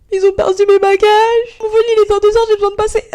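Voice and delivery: high, pleading, near-panicked voice; stressed traveller begging the officer to pass; desperate plea. Retold or imitated.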